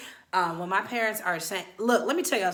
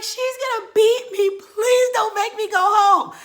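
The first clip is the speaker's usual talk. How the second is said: very high, loud wail; hammed-up crying; 11 dB louder, slower than usual. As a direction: bawl and beg like a terrified child. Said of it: "Why is she crying?".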